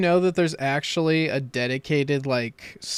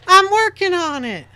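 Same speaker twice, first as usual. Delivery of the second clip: squeaky, high whiny voice; high mock voice; 8 dB louder than usual. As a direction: mimic someone in a high whine. Mocking a quote in funny voice.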